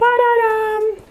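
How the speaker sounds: high, drawn-out sung ba-da-dum; a little vocal drumroll for the reveal; sung fanfare. Real, not acted. Vlogger presents her package to viewers, sung fanfare.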